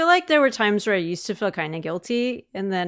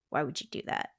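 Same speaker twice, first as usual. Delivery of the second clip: hushed, breathless quoting of the husband; whispered mock question; 4 semitones lower, 11 dB quieter than usual. Wife quoting her husband's rare complaint, whispered. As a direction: breathe the question out, barely voiced. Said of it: "there's not much that he's like".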